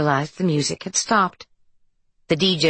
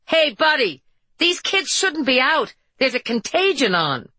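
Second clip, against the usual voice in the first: high, raised voice calling out; yelling; 9 semitones higher than usual. A narrator's reading. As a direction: call out past the kids. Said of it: "She raised her voice and yelled past them".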